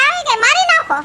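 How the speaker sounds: daring him to do it; defiant shout. Acted. Wife defiantly challenges husband's threat. (પડકારતી બૂમ)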